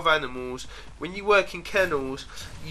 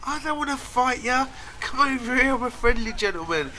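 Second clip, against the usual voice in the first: much higher, coaxing dog voice; a gentle dog calling a new friend over; voicing the friendly dog; 10 semitones higher than usual. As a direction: sweet-talk the other dog as Jacko would. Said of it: "his way of, like, going".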